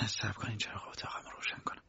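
breathy unvoiced whisper, barely there. Young woman whispering in the dark hallway to K. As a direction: whisper so the sleeping house stays quiet. Late night, avoid waking house.

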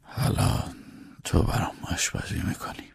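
soft, spent, whispered remark; weak whisper. Acted. Abandoned master to the servant who cooked, whispering weakly. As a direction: say it with hardly any voice.

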